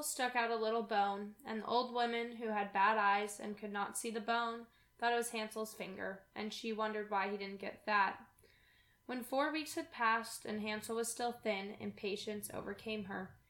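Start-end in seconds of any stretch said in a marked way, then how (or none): none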